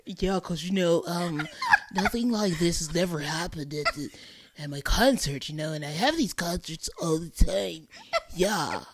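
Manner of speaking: low, mock California dude accent; California valley accent. Host imitating the rapper's interview, mock California valley accent. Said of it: "South Beach, California valley ass accent".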